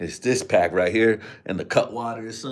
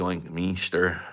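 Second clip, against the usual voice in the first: usual speaking voice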